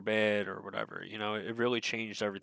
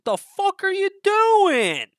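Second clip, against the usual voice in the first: loud, high, drawn-out screamed outburst; yelling; 12 dB louder, slower than usual. Retold or imitated. His body's cells screaming at him, high drawn-out yell. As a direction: yell it at full force, stretching the words. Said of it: "yelling at me".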